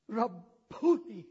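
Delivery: "Rabboni" drawn out, slow, high and loud; joyful recognition. Acted. Mary recognizing Jesus at the tomb, slow loud joyful cry.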